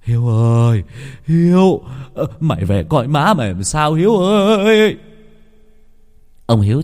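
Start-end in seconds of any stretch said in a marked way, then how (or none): 0.0-5.0 calling after son. A narrator's reading. Father runs after his son with the knife, calling. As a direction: holler the name into the distance. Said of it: "chạy theo gọi con".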